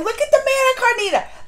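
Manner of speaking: high, loud, thrilled burst; excited high exclaim. Real, not acted. Vlogger gushing to viewers about the queen, loud and high. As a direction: gush over the queen loudly.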